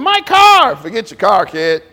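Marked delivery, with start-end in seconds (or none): 0.0-0.8 high, louder whine; whiny daughter. Retold or imitated. Daughter after the accident, high whine. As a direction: mimic a daughter fretting over her car. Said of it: "talking about".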